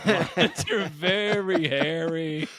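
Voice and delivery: hammed-up repeat of a daft explanation; goofy put-on voice. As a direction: stretch it out goofily through laughter. Repeats the silly line, bigger.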